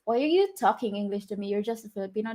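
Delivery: normal talking voice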